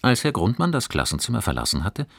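plain reading voice

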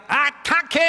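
loud high charge call; battle cry. Acted. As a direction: shout the charge.

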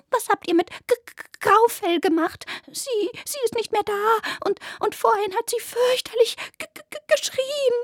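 tripping over words in fright; stammering fear. Acted. Rabbit stammers in fear about missing Graufell.

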